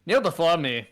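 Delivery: commanding cat lord, laughing; dark lord. Acted. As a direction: demand obedience from a subject.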